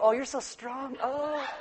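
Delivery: soft, high, breathy-free swoon; swooning admiration. Pretend. Wife admiring husband's muscles, soft high swoon. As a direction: coo over his strength. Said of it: "touch his muscles and go".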